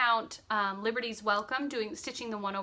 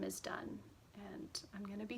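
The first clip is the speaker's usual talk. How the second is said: whispering; 3 semitones lower, 15 dB quieter than usual. Real, not acted. Whispering while showing stitching.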